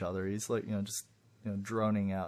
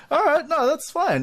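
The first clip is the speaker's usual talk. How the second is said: laughing; 7 dB louder than usual. Real, not acted. Laughing at wrong answer.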